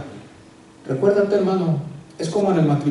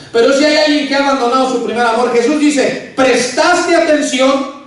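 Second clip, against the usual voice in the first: higher, louder impassioned sermon; preaching at full force; 7 semitones higher, 4 dB louder than usual. Acted. Pastor urging the congregation to keep their first love, loud and fervent. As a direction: drive the message home to the congregation.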